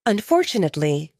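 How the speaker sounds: ordinary delivery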